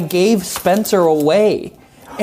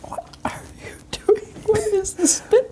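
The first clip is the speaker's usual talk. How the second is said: whisper; 5 dB quieter than usual. Pretend. Mock creepy whisper bit.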